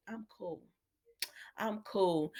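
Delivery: soft, understated cool; unbothered. Retold or imitated. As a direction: say it quietly, as if nothing gets to you. Retells character staying unbothered.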